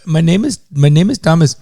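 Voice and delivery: loud, insistent fake Indian accent; Indian-accented agent insisting on his name; mock Indian accent. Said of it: "with an Indian accent".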